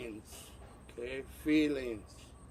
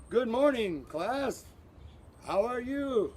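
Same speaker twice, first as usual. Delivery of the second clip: bright high class greeting; teacher greeting; 7 semitones higher than usual. Acted. Teacher greeting his class, a high cheery hello.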